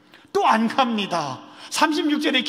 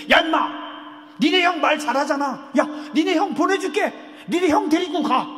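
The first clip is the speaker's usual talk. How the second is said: fed-up scolding; 6 semitones higher than usual. Acted. God losing patience with Moses, angry scolding. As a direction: bark it out like a fed-up boss whose patience is gone. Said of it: "승질 냅니다".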